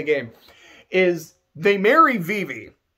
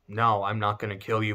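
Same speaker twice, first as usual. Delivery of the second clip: deadpan Zidane; 11 semitones lower, 8 dB quieter than usual. Retold or imitated. Zidane voiced refusing, deadpan.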